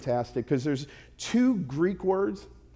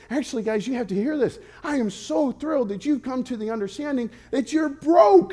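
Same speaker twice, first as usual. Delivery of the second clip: thrilled; 8 semitones higher than usual. Retold or imitated. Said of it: "here's what he's saying".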